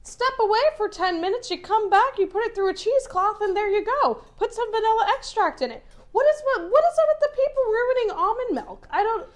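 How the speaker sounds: high-pitched, worked-up rant; getting emotional. Real, not acted. Telling people how to make almond milk, getting emotional. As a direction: let exasperation lift the pitch right up. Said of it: "I'm getting emotional now".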